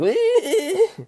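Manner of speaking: a high, crying-like burst of laughter. Real, not acted. Vlogger laughs hard, high, at his stubborn dog. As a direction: laugh till it squeaks.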